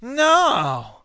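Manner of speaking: high, drawn-out whiny "no"; Scott Walker. Retold or imitated. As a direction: whine it like a sniveling guy. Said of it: "I should do it like a whiny, sniveling guy".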